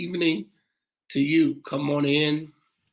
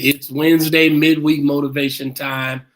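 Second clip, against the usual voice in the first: upbeat welcome; 8 dB louder than usual. Real, not acted. Opening the livestream, hyping viewers.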